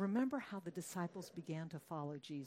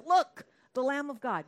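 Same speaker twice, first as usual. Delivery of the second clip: loud, high proclamation from the preacher; a prophet's ringing cry, much louder; calling out; 7 semitones higher, 14 dB louder than usual. Acted. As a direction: shout it out like a prophet pointing. John the Baptist calls out again.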